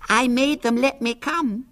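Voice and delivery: put-on very high lisping six-year-old girl; squeaky, self-satisfied small child; Violet Elizabeth Bott. A narrator's reading. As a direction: announce it in a shrill little lisping voice. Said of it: "with a lisp".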